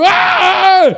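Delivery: loud, high panicked scream; terrified scream. Retold or imitated. Driver fleeing through the red light, terrified scream. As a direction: scream in panic, re-enacting the fright. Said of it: "Just terrified, didn't know what to do".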